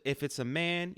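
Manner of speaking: ordinary delivery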